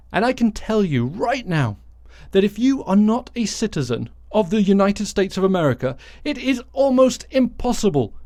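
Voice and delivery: ordinary talk, nothing marked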